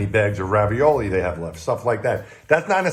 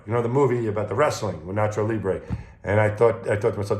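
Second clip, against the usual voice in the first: ordinary delivery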